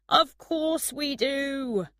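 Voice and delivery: high, slow called-out answer; mild shout back over a distance. A narrator's reading. Man looks back at her as he leaves, shouting back. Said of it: "look back at her and shouted".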